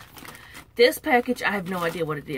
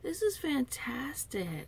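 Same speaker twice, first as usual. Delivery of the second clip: whisper; 5 semitones higher, 6 dB quieter, slower than usual. Real, not acted. Whispering over the gift.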